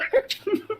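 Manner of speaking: high giggle. Real, not acted. Laughs at own bit.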